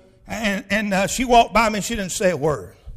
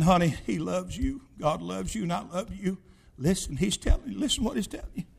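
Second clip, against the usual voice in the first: soft, gentle grandmotherly reassurance; tender coaxing; 3 semitones lower, 8 dB quieter than usual. Retold or imitated. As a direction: gently urge someone, voice dropped. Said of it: "she was patting him on the hand".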